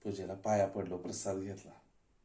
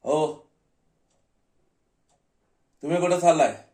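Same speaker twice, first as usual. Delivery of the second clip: loud, raised-pitch guard stopping someone; security guard challenge; 7 semitones higher, 10 dB louder than usual. Acted. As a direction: call out sharply to halt an intruder. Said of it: "सिक्युरिटीनं अडवलं".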